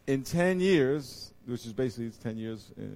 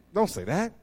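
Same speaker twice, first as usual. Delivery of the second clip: ordinary talk, nothing marked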